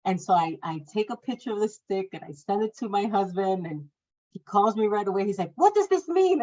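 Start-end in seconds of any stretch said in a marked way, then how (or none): 5.6-6.4 baffled. Retold or imitated. Husband reacting to photo.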